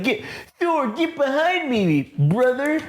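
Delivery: high, strained hero voice, drawn out; Captain America. Acted. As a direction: slowly heave out a heroic command. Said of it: "Captain America rolls in and he's like".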